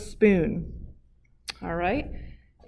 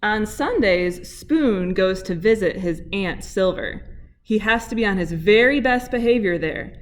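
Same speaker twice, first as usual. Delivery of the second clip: storybook reading. Acted. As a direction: read it like picture-book time for kids. Picture book read to kids.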